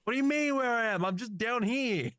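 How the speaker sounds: very high, whining robot; whiny robot voice. Acted. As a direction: squeal it, way up high. Thoven voiced by GM.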